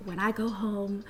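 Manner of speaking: whispered, fast and soft; seething aside after the awful date; furious whisper. Retold or imitated. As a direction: hiss it under your breath.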